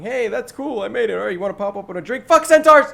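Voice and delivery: high, giddy chatter ending in a sudden yell; mock npc chatter. Gamer voicing careless npcs to his podcast friends, high giddy chatter then a yell. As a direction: play a carefree npc who shouts the last words.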